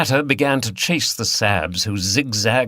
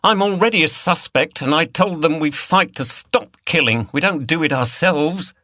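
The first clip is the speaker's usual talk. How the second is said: raised, high indignant voice; 7 semitones higher than usual. A narrator's reading. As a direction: protest as she's bundled off. Liz bundled away.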